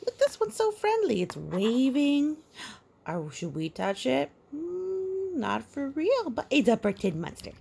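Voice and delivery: high, fast, delighted; thrilled by the picture. Real, not acted. Excited with child.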